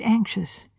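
usual speaking voice

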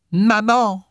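put-on very high child's call; a small child calling out for mother; childish voice. A narrator's reading. Spirit child calls with arms held out, childish cry.

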